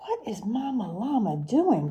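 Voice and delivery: hushed storytime whisper. Acted. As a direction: breathe it out as a secret. Storytime suspense question to kids.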